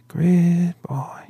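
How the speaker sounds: breathy whispered echo of the jingle. A narrator's reading. David whispering the jingle's last words from the corner. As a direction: let the tune fade into a whisper. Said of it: "a voice sang from the corner".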